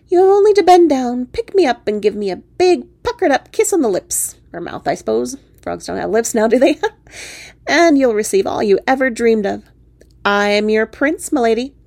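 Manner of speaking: loud, high opening, grand and theatrical; grandly court a lady as a frog prince; big declaiming frog suitor voice; wooing frog prince. Acted. Frog prince demands a kiss.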